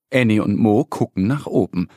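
usual speaking voice

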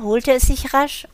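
usual speaking voice

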